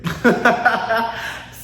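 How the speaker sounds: high cackling laugh. Real, not acted. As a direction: crack up at your own joke. Bursts out laughing at own remark.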